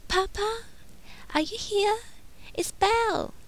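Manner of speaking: put-on high, hesitant young woman's voice; young heroine. A narrator's reading. Belle turning down Gaston's proposal, high hesitant heroine voice. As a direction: lift the voice and stumble politely.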